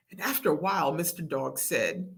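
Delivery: ordinary delivery, nothing marked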